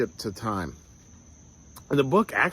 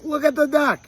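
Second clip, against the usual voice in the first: put-on high, excited child's cry about a duck; excited little girl; 5 dB louder than usual. Daughter spotting a duck at Disney World, high and thrilled. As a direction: squeal it like a delighted kid spotting a duck. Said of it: "she's all excited, like".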